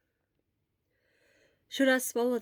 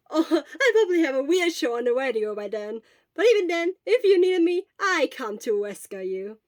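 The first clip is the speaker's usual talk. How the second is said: bright, loud and high with a laugh; laughing cheerful promise; 5 semitones higher, 9 dB louder than usual. Acted. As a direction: grin through an upbeat vow. Cheerful Hisashi laughs then promises.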